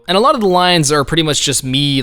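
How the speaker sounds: normal talking voice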